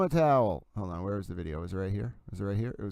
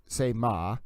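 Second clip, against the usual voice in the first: ordinary talk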